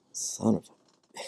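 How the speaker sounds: hushed, drawn-out swearing under the breath; slow, voiceless retold whisper; whispered curse. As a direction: whisper it slowly in stunned realization. Retells his stunned realization.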